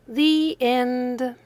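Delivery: ordinary delivery, nothing marked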